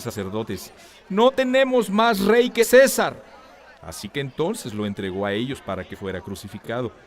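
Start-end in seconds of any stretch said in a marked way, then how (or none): 1.1-3.2 defiant crowd shout. Acted. Chief priests answer, crowd voice.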